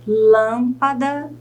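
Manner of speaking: "Lâmpada" drawn out, LÂM held; stressed syllable exaggerated. Shown as a demonstration. Teacher has students find the stress, "Lâmpada" drawn out on LÂM. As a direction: stretch "Lâmpada" slowly, leaning on its first syllable. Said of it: "tente analisar qual dessas sílabas é a sílaba tônica".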